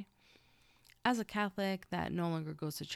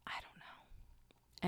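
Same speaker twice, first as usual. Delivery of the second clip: whispering; 10 dB quieter, much slower than usual. Real, not acted. Whispered aside after swearing.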